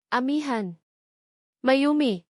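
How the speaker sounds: put-on mother calling out her missing children in alarm; frantic calling. A narrator's reading. A mother calls out for her lost children, alarmed. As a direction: call their names out, panicked. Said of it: "sigaw ni Nena".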